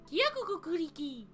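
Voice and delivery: high-pitched gibberish creature voice; squeaky creature. Acted. Newlywed husband chiming in, squeaky creature voice. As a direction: chirp it in a high put-on voice.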